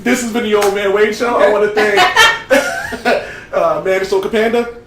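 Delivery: real laughter running through the words; laughing sign-off. Real, not acted. Host closing the show after a joke, laughing.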